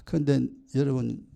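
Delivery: normal talking voice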